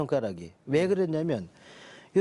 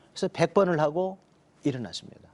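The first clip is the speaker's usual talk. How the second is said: ordinary talk, nothing marked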